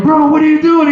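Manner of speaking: high, loud, alarmed question; acted panicked shout; alarmed yell. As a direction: yell at the driver in disbelief. Cousins yell at stoned driver.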